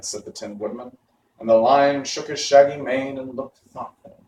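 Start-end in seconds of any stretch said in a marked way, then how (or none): none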